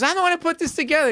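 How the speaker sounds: high, fast, huffy lady voice; imitating organizer lady. Retelling the show, voicing the lady who ran it in a high huff. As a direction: snap it out like an offended organizer. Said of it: "she goes".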